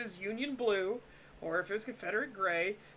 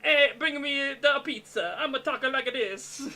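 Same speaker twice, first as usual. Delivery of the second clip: hammed-up Italian-American accent, louder; 4 semitones higher, 6 dB louder than usual. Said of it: "the constant stereotype of".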